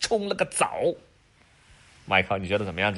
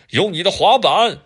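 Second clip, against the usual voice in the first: loud, higher calling out; 8 semitones higher, 11 dB louder than usual. Acted. Dad calling down the drain to Adam, loud call.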